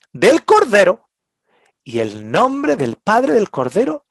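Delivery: emphatic. Acted. Preacher exalts the Lamb.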